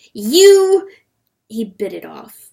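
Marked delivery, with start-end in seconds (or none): 0.1-0.9 high, very loud angry outburst; furious cry cut off; vicious. A narrator's reading. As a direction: lash out on a high, loud word. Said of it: "began a vicious sentence".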